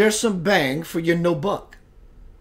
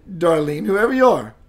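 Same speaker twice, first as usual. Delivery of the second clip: ordinary talk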